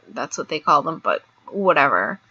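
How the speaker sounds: normal talking voice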